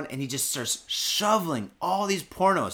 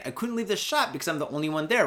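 usual speaking voice

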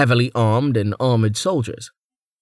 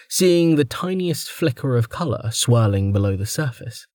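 usual speaking voice

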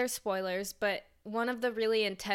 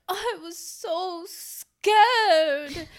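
high, slow swooning damsel line; old Hollywood starlet; 9 semitones higher, 8 dB louder, much slower than usual. Retold or imitated. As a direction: gasp it like a 1950s heroine. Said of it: "they all talk like this".

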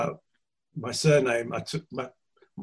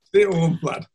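put-on higher, louder Yorkshire accent; 5 semitones higher, 6 dB louder than usual. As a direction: say it like a bluff Yorkshireman. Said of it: "do it in a Yorkshire accent".

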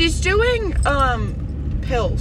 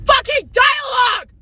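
high, loud exasperated yell at the TV; a kid fed up with a show that has no dialogue, hammed-up shouting; mock outraged shout; 12 semitones higher, 5 dB louder than usual. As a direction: blow up in mock fury at a silent cartoon. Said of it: "and I'm like".

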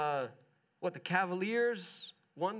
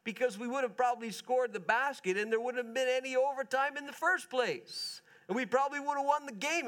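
raised, fast frustrated protest at a teammate; retold outburst; 6 semitones higher than usual. LeBron protesting to a teammate after the blunder, heated. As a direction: argue it quickly, pitched up. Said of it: "He's saying".

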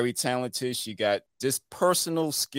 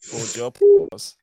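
louder mock hush of the crowd; milking the applause; 8 dB louder than usual. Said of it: "All right, everybody".

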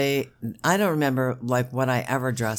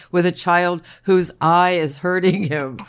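loud, higher sarcastic rant; 6 semitones higher, 7 dB louder than usual. Real, not acted. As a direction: ham up the fake delight. Sarcastic rant about emergency room.

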